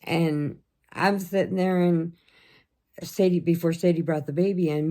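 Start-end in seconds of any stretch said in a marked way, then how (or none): none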